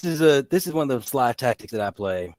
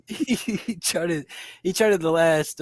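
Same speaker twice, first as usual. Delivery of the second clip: laughing; 8 semitones higher, slower than usual. Real, not acted. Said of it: "I can't stop laughing".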